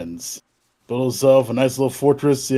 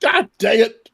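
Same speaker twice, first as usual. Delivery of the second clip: a higher, hammed-up groan of annoyance; mock frustration; 9 semitones higher than usual. Game master fakes exasperation at the player's accent. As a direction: pretend to be fed up.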